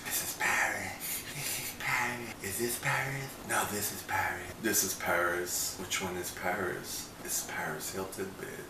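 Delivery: soft, breathy-free little doll voice imitation; lightly imitated little doll voice. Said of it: "she does her little doll voice".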